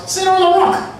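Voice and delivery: high, loud barked order; mock yelled instruction; retold shouting. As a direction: shout it as a command. Workers ordering them to sit.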